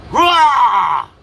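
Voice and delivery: loud high roar; big playful lion roar. Shown as a demonstration. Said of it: "Come on. You do it".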